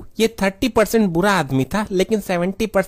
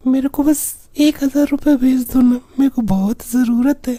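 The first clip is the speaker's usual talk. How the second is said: put-on high girlish phone voice; coy young woman voice; man doing a woman's voice; 5 semitones higher, 3 dB louder than usual. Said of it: "तुम्हारी आवाज तो बहुत अच्छी है".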